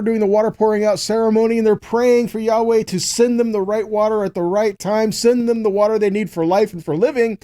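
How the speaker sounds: ordinary talk, nothing marked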